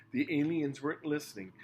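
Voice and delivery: usual speaking voice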